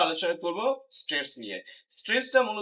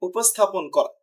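usual speaking voice

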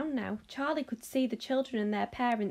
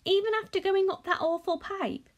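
astonished; 10 semitones higher, 4 dB louder than usual. Acted.

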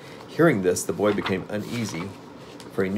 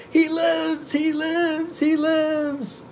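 very high, drawn-out rapturous cries; much slower than usual. A narrator's reading. Witch hugging herself and dancing a jig, rapturous. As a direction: chant it shrieking with rapture. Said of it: "all the time repeatedly rapturously".

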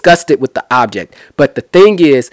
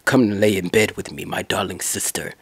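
low, much softer vampire croon; Count Dracula voice; 7 semitones lower, 10 dB quieter than usual. Retold or imitated. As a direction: purr it low like a hammed-up Dracula. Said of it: "sounds like Count Dracula".

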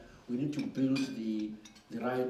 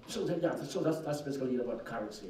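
usual speaking voice

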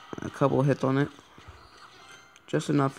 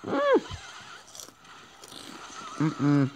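talking while chewing chips; crunching chips; 3 semitones higher, 5 dB quieter than usual. Real, not acted. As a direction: mouth full of chips. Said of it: "I'm crunching on chips".

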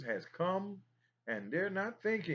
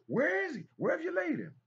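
called-out question; 14 semitones higher, faster than usual. Acted. Preacher voicing Jesus asking.

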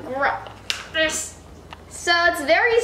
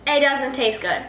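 usual speaking voice

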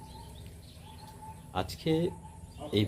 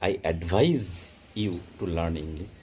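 slow example sentence; much slower than usual. Shown as a demonstration.

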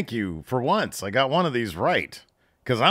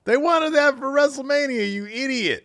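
real frustrated outburst; exasperated shout; 7 dB louder than usual. Real, not acted. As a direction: shout down a dumb prediction. Yelling at his past prediction.

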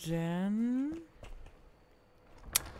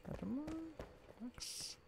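fast geflüstert vor sich hin; leises Murmeln; 9 semitones higher, 14 dB quieter, much slower than usual. Real, not acted. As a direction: murmelt beim Suchen im Inventar. Sucht murmelnd im Inventar. (leise vor sich hin gemurmelt)

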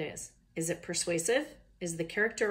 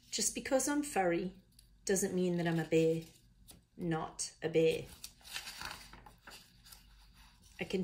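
put-on amateur Australian accent for a koala narrator. Koala explaining to young listeners how this started, attempted Australian accent. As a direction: read it with your best try at Australian. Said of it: "read this in an Australian accent".

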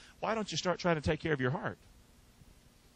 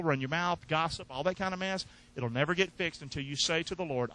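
ordinary talk

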